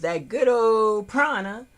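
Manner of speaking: playful nod to a yoga teacher; imitating Infinite Water. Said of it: "as Infinite Water says".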